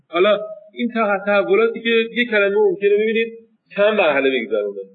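ordinary talk

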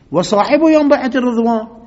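proud rising proclamation; defiant boast. Acted. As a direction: throw the merit back at the caliph. Ibn Masud defiantly lists his merits.

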